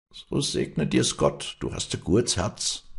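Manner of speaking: put-on high, slow old man's blessing; dankbarer alter Knecht. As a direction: bless her wearily and gratefully. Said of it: "sagte der Mann und setzte sich müde". (müder Alter, dankbar segnend)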